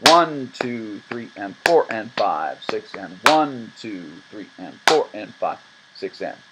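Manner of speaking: "One" and "four" accented in six eight; counting six eight with accented beats; stress on one and four. Shown as a demonstration. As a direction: lean on one and four again. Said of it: "Switch back".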